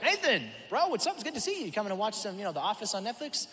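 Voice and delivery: high, breezy, chummy greeting; carefree host welcoming a friend over; casual bro voice. Retold or imitated. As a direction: play David as a laid-back buddy. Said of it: "And David's like".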